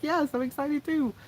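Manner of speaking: high, sing-song mock reply; teasing high-voiced mock answer. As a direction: mimic him gushing back, high pitched. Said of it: "He'll be like".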